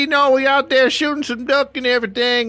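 high, loud hammed-up Southern voice of a duck hunter; a hillbilly hunter reporting a body, played for laughs, in a Southern accent; mock Southern hiker accent. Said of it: "that's your accent".

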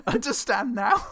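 high, slow, laughing taunt; laughing mock taunt, high; smug sister. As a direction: gloat with a laugh. Sister after smacking him.

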